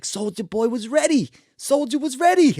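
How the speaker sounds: high, soft squeaky imitation of a boxer; put-on high voice, mock-insistent; imitated high voice. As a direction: do a playful high-pitched impression, softly. Retells Mike Tyson's words, imitated.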